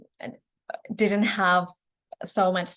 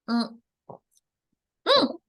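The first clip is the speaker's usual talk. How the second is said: normal talking voice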